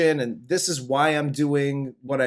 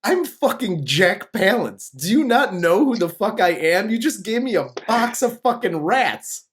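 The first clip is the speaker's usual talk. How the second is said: Jack Palance losing his shit; 4 semitones higher than usual. Retold or imitated. Actor handed a box of rats, indignant rant. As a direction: start baffled, build to an indignant rant. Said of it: "losing his shit".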